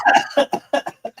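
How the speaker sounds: high squeal. Retold or imitated. Imitating Mulan's reaction.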